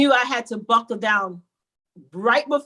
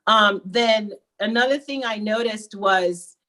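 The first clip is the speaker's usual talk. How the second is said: ordinary delivery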